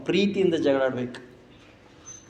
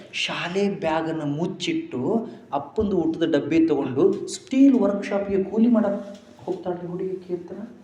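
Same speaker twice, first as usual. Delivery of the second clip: normal talking voice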